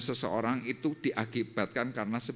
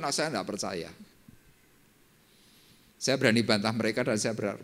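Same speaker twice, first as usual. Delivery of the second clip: usual speaking voice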